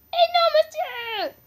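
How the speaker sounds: high, loud "Eh non"; sting of a wrong guess; dismayed cry. Real, not acted. Vlogger reacting to a wrong guess with a high loud cry.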